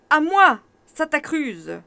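appel lent et puissant; cri de guerre. Acted. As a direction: crie au secours, lentement. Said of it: "s'écrie Thiébaut". (cri de chevalier, fort et lent)

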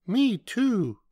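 tiny, very high joey voice; young kangaroo. A narrator's reading. Joey echoing mother from her pouch, high little voice. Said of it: "the young kangaroo in her pouch said".

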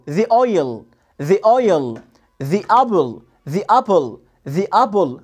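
"the" as "thee" before "oil" and "apple"; drilled "thee oil", "thee apple"; "thee" before vowels. Shown as a demonstration. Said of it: "عشان قبلها فاول".

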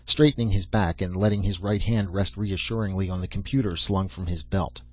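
plain reading voice

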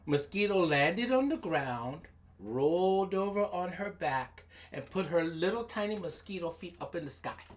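ordinary delivery, nothing marked

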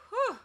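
high, loud puff of relief; relieved whew. A narrator's reading. Polly once the queen has left the room, relieved whew. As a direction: let out a long breath of relief. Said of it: "letting out a long breath of relief".

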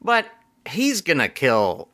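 ordinary delivery

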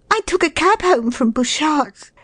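very high woman's character voice; flustered lady. A narrator's reading. Lost woman to the flat's owner, flustered. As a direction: explain yourself in a high, anxious voice.

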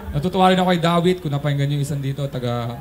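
ordinary talk, nothing marked

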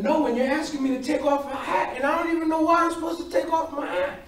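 retold bewildered plea, rising steadily in pitch; pleading protest. Acts out the hurt kid's plea.

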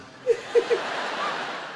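short high-pitched breathless laugh; real giggle fit; high wheezing laugh. Real, not acted. Comedian dreaming of carbs, high wheezing laugh.

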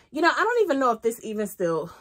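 ordinary talk

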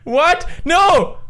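high cries rising to a wail; anguished disbelief. Real, not acted. Player losing at the quiz, anguished wail. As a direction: wail at the unfairness.

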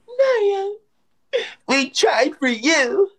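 high, drawn-out mock lament; high put-on whine of defeat; mock wail. Mocks the losing side.

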